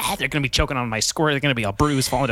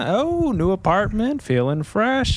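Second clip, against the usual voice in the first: high, bouncy mock-chipper small talk; mock cheery neighbor; 7 semitones higher, slower than usual. Mocking what neighbors would say, chirpy and high. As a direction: gush like a nosy neighbor noticing everything. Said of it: "there's nothing that I'm like".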